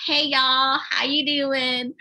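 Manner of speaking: put-on Southern accent, drawn out at the end; playful Southern accent. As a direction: mimic a friendly Southern US greeting. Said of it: "try to talk in a Southern accent".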